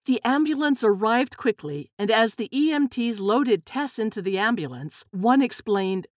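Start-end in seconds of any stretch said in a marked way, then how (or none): none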